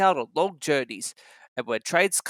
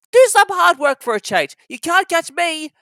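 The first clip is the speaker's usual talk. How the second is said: high, loud cheeky little engine; 12 semitones higher, 8 dB louder than usual. Acted. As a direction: tease him and dash off. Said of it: "came up in his cheeky way".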